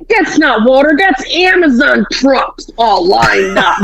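put-on voice, slowing down as it goes; hammed-up exasperated correction; mock exasperation. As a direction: spell it out like a fed-up dad. Retold exasperated dad corrects mom.

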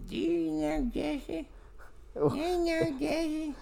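high, slow, soft-ish put-on voice. Teasing his friend with a high, slow put-on voice. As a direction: ask it in a silly high drawn-out voice.

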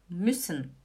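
"Müssen" with a quick short ü; short vowel. Shown as a demonstration. Teacher repeating the correct word for students, short vowel. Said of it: "eu falo müssen".

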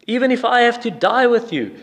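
high, emphatic pledge; acted-out zealous vow; vehement insistence. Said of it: "He kept on and kept on saying this".

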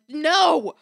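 loud high emphatic no; flat refusal. Retold or imitated. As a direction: slam the door shut. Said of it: "I'm like".

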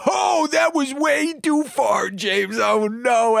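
high, shouted mock outrage; mock outraged cohost. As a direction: yelp it high like a scandalized friend. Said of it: "And then you go".

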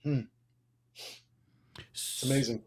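real emotion welling up, barely getting it out; choking back tears; choked up. Real, not acted. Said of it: "probably get choked up".

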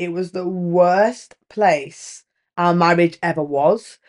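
ordinary delivery

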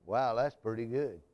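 low and soft, barely reacting; a dull, muted "Wow, that's pretty good"; flat, unimpressed mumble. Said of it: "When you get some good news, you say".

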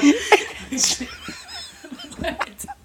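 long wheezing laugh, mostly voiceless; helpless, high wheezy laughter; breathless laughing fit. Real, not acted. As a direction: laugh until the air runs out. Cracking up over the accident.

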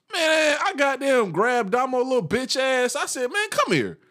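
rising up in outrage; a worker pulls his friend aside, high and outraged; outraged disbelief. Retold or imitated. Outraged retelling, voice shoots up.

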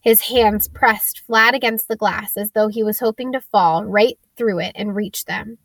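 ordinary delivery, nothing marked

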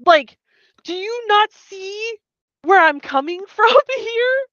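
hammed-up exasperation with a laugh; exasperated plea. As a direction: beg him to understand, voice cracking up high. Leia pleading to be understood.

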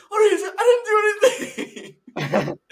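high, loud, hammed-up protest; mock panic. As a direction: squeal it like a cornered suspect. Acted caller panics during police raid.

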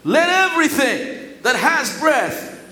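loud, high, slow proclaiming of praise; rising toward a shouted finale; emphatic proclamation. Shown as a demonstration. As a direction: shout each "Praise him" out like a preacher. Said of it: "I read it with that emphasis".

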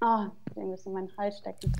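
choked, muffled words after coughing; coughing fit. Real, not acted. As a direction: talk through a catch in the throat. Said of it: "Sorry, ich muss kurz husten".

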